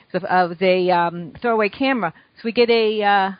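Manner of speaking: ordinary talk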